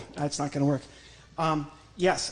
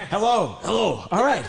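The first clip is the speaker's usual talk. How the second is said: loud greeting; 4 semitones higher, 8 dB louder than usual. Acted. Ventriloquist greets the puppet loudly.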